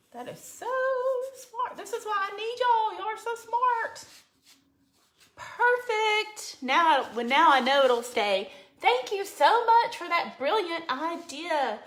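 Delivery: delighted, high praise. Real, not acted. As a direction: gush over the clever idea. Delighted by a viewer's toothpick tip.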